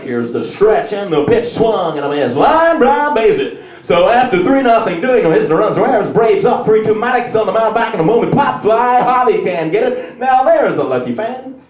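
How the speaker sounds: excited radio play-by-play; baseball announcer. Retold or imitated. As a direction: call the game like a radio announcer. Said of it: "they're like".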